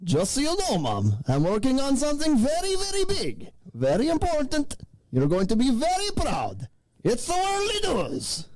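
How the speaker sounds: high, stretched announcer voice calling to mom; hammed-up intro voice. Podcast host to his mom, high hammed-up announcer voice. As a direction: play it like a kid showing off to mom.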